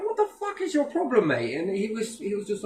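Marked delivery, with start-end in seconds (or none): 0.0-1.5 a much higher, half-acted challenge to a stranger; retold challenge. Confronting a pub stranger, high mock challenge. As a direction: fling the question at him, pitched up.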